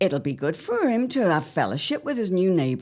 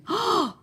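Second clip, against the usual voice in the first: sharp, high intake of breath; startled dramatic gasp; alarmed gasp. Acted. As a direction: gasp in sudden dread. Wife frightened by foreboding.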